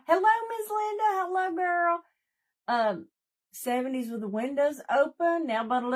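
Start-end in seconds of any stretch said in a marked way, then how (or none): 0.1-2.0 high, bright-up hello to a viewer; excited greeting. Real, not acted. Greeting Miss Linda in the chat, excited and high. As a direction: sing out a hello to a friend.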